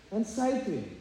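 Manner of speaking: ordinary talk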